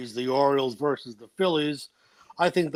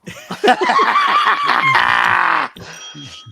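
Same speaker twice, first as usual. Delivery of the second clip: loud laugh; 7 dB louder than usual. Real, not acted. Big reaction to his own pick.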